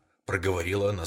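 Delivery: ordinary delivery, nothing marked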